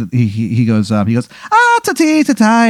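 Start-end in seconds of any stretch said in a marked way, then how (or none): 1.5-2.7 high, hammy fake Irish accent; terrible Irish leprechaun voice, pitched way up; bad Irish accent. GM voicing a leprechaun NPC to the player, bad Irish accent. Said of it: "the worst Irish accent you've ever heard".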